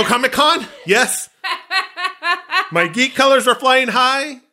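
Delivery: giddy laughing geek confession. Real, not acted. Geeky excited confession, laughing.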